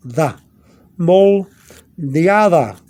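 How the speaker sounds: "Moldeada" slowed right down, "Da" said as "tha"; TH sound demo. Shown as a demonstration. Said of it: "You see a T H sound?".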